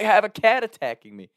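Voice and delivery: high, loud frantic reply; panicked protest. Retold or imitated. Being clawed by a cat, a high panicked shout. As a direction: shout it while wrestling a cat.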